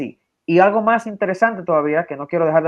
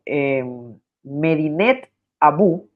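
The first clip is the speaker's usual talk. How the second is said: slow dictation; much slower than usual. Real, not acted. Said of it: "slowed dictation".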